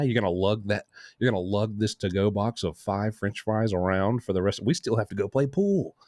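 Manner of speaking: ordinary talk, nothing marked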